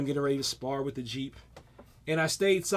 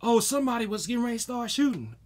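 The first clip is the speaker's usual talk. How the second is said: confused running; 7 semitones higher, faster than usual. Retold or imitated. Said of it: "I just started running to".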